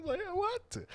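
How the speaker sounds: very high, squeaky fake plea; mock whining through laughter. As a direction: whine it again, breaking into laughs. Repeats the mock plea while laughing.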